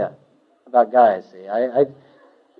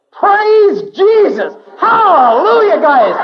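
much higher, slow, stretched-out jubilant yell; ecstatic shout; 5 dB louder, much slower than usual. Retold or imitated. New convert bursting through the door at his housemates, shouting. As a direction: burst in hollering joy.

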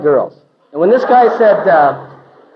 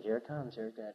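much softer, a half-laughed dread under the breath; nervous hush; 5 semitones lower, 25 dB quieter than usual. Retold or imitated. Convert murmuring as the preacher approaches him, nervously. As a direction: brace yourself, murmuring it low. Nervous as hands are laid.

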